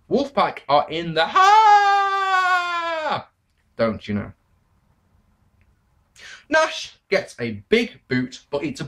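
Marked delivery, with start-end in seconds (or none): 0.1-3.3 high, loud, stretched-out last word; drawn-out catchphrase shout. Acted. Reviewer echoing a Wolfpack catchphrase, long high shout. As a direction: holler it like a wrestling catchphrase, dragging out the end.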